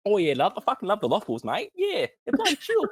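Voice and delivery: high, quick hammed-up British accent; mock British accent. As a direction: gush about Loth Wolves like a cheery British bloke. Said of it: "He's British".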